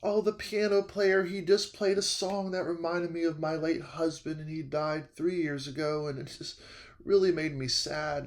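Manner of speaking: fragile, quiet mock-tearful voice; tearful old lady. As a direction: imitate her holding back tears, very softly. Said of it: "has tears in her eyes".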